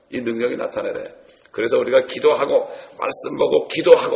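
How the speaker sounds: usual speaking voice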